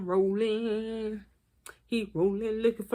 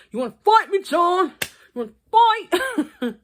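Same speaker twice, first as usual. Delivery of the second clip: high, loud mock challenge to a fight; mock tough guy; 9 semitones higher, 6 dB louder than usual. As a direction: bark it like a dad ready to fight. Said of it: "He was like".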